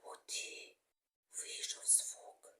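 slow, hushed whisper of discovery; amazed whisper. Pretend. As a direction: whisper it like a small wonder. Delighted whisper after the sound works.

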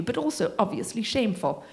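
plain reading voice

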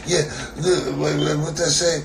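ordinary talk, nothing marked

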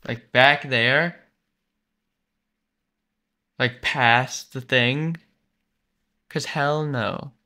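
slow, loud, drawn out; slow disbelief. Real, not acted. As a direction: spell out the horror slowly. Slow stunned refusal.

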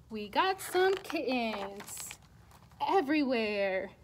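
ordinary delivery

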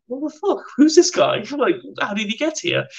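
high, startled mock disbelief; a guard's confused double take; baffled Taliban guard. Guard baffled by a stranger arriving, high startled voice.

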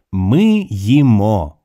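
slow, proud announcement. A narrator's reading. Lingo adding her own Ukrainian words, slowly and proudly. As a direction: say it proudly, stretching each word. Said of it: "added Lingo proudly".

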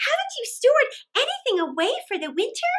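high, fast, astonished ants; surprised cry. Acted. Ants scold the starving grasshopper, high, quick and astonished. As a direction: blurt it out in shocked disbelief. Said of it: "cried the ants in surprise".

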